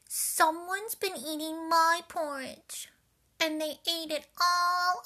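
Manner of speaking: put-on crying Baby Bear. A narrator's reading. Baby Bear finding his bowl empty, high loud wail. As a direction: wail it like an upset small cub. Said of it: "cried Baby Bear".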